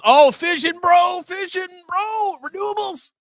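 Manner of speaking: high, hyped-up mock bro voice; mocking hype. Host mocking excited nuclear bulls, hammed-up hype. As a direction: parody the hype crowd, rising to a shout.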